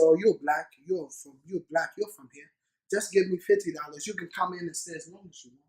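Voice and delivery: an easygoing official waving him through; lightly voiced border officer.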